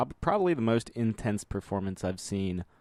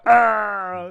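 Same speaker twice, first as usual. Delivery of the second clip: loud, high mock death scream; imitated death scream; 12 semitones higher, 9 dB louder than usual. Gamer mimicking the best death scream in gaming, mock scream. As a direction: cry out a video game death wail. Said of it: "it's the best death scream in gaming history".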